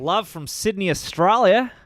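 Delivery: high, loud hammed-up Australian accent. Shown as a demonstration. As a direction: announce it like a broad Australian. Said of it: "read this in your best Australian accent".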